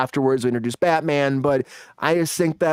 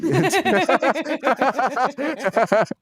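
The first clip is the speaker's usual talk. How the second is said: high, rapid cackle; long real fit of high laughter; hearty laugh; 7 semitones higher than usual. Real, not acted. Host cracking up at a viewer joke, hearty laugh.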